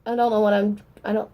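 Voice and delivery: ordinary talk, nothing marked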